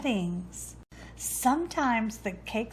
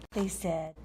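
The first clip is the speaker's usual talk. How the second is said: ordinary delivery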